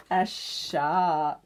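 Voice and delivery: plain reading voice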